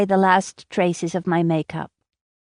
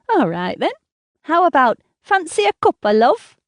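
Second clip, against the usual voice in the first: high, nasal northern English demonstration; northern English accent; 10 semitones higher, 5 dB louder than usual. A narrator's reading. Seatmate teaching an American a phrase, northern English accent. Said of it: "teach me a few sentences to say in your accent".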